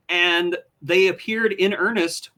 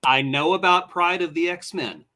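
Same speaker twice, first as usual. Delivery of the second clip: ordinary talk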